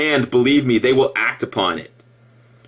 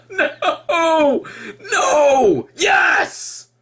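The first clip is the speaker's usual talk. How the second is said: high, laughing no then yes; giggly high outbursts; laughing exclamations; 13 semitones higher, much slower than usual. Real, not acted. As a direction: protest through laughter, then give in. Laughing denial then admission.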